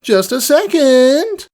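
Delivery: high, drawn-out sung reply; sing-song ladylike call. Acted. Rarity calling from inside her shop, sing-song reply. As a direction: lilt it through the door. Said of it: "a voice sang from within".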